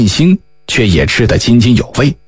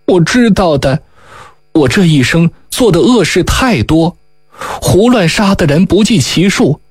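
higher, lightly voiced young woman full of regret; a young woman sadly confessing her misdeeds to her lover; rueful young woman; 6 semitones higher than usual. A narrator's reading. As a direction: confess your past with a sigh, voice raised. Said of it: "殷素素叹了口气道".

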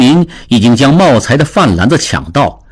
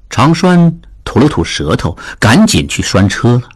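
usual speaking voice

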